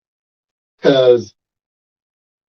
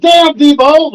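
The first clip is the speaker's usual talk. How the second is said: high-pitched mock yell from the crowd; retold yell; 11 semitones higher, 4 dB louder, slower than usual. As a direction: call it out loud, imitating a heckler. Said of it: "somebody yelled out".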